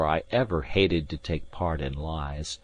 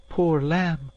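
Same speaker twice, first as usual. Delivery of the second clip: pitying maid; 10 semitones higher than usual. A narrator's reading. Maid pities the groaning lad.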